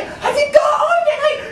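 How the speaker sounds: put-on high shrieking girl voice, laughing; squealing girl. Retelling the girl's question, high laughing shriek. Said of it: "she's like".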